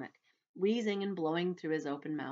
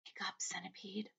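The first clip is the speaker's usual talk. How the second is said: breathy unvoiced whisper; 2 semitones higher, 10 dB quieter than usual. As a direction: gently whisper him awake. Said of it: "whispered James".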